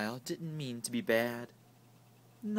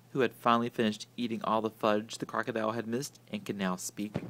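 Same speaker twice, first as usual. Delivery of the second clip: ordinary delivery, nothing marked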